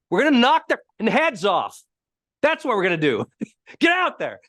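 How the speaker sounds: high, punchy imitation of a rallying coach; assertive coach. Coach answering his panicking quarterback, acted assertive rally. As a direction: fire up the team, acting out the coach. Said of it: "how he got so assertive in it".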